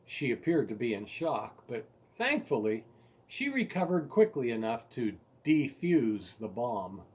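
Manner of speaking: usual speaking voice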